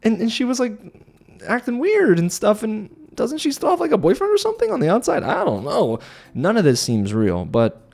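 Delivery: high, fast and amazed; incredulous excitement. Real, not acted. Recapping a shock proposal to viewers, high and incredulous. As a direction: blurt it out in disbelief.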